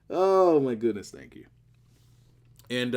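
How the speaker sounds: normal talking voice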